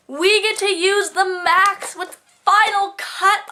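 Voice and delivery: high, slow, thrilled gush; excited. Real, not acted. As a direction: savour each word with glee. Excited gush about class.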